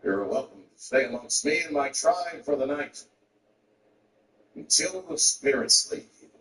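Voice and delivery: slow, measured chief's voice. Acted. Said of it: "And the chief threw".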